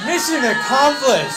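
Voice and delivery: loud, high victory shout; mock-heroic triumph; triumphant proclamation. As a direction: crow it like the mission's won. Triumph at news of the delay.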